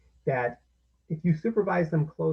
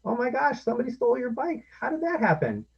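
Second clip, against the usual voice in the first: much higher, louder surprised concern; sympathetic surprise; 11 semitones higher, 4 dB louder than usual. Shown as a demonstration. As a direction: gasp with the child over the stolen bike. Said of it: "you can say".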